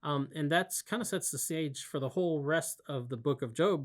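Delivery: ordinary delivery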